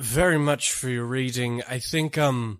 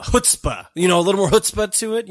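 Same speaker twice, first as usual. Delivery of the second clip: more chutzpah; 5 semitones higher, 5 dB louder than usual. Acted. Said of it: "try have a little more".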